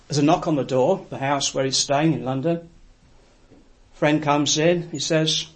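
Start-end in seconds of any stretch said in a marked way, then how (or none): none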